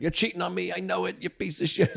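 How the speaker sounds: put-on high, laughing Italian girlfriend accusing; Italian girlfriend accusing her boyfriend of cheating, in mock fury; mock Italian accusation. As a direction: fling it like a fiery Italian girlfriend. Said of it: "She was Italian".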